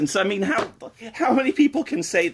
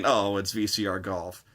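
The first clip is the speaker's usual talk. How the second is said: deadpan letdown; 6 dB quieter than usual. Acted.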